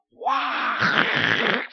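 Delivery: sudden shouted wow; mock thrill shout. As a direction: cry out as the coaster drops. Coaster plunging down the hill.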